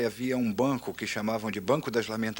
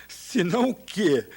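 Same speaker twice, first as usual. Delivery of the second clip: amused divine challenge; 8 semitones higher, 4 dB louder, slower than usual. Retold or imitated. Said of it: "eu quase podia ouvir o riso".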